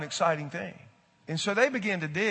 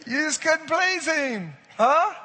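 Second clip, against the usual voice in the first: much higher and louder, slow; amused exclaim; 11 semitones higher, 6 dB louder, slower than usual. Real, not acted. Preacher to the congregation, higher and louder, amused. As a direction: call it out amused.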